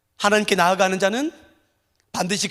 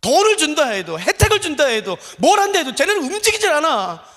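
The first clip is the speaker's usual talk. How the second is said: loud, high, driving sermon climax; full-force preaching; 9 semitones higher, 8 dB louder than usual. Acted. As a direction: hammer each clause home to the congregation.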